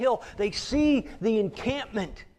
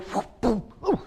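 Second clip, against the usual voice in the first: playful splat sound effect, whoop then boom; tomato throw noise; 2 semitones higher, 5 dB louder than usual. Retold or imitated. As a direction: mimic a tomato flying and splatting. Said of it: "somebody takes a tomato and goes".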